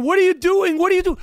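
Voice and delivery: high, mock-baffled repeated protest; imitating a passenger confronting marshals; questioning lady. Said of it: "She's questioning".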